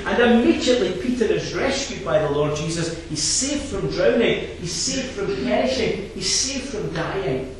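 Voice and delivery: loud, high, rousing preacher's voice; full-force preaching. Acted. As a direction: drive the verses home from the pulpit.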